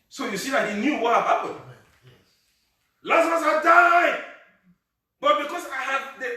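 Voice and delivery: very high, loud, shouted climax; preaching at full force. Acted. Pastor shouting God's promise to the congregation, high and loud. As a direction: roar the promise at the church. Closing call, wake the dead.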